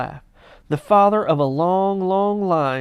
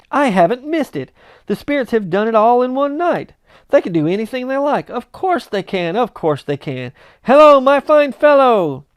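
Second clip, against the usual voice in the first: higher and louder, ending in a loud call; excited relief; 7 semitones higher, 7 dB louder than usual. Acted. Scrooge calling to the boy, excited. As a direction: rejoice then hail the boy.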